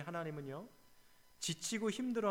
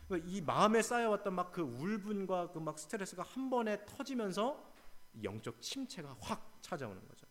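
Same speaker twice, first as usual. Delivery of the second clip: usual speaking voice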